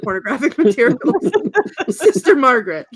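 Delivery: loud, real laughter breaking through; laughing while quoting. Real, not acted. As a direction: crack up mid-line.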